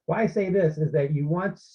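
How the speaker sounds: ordinary delivery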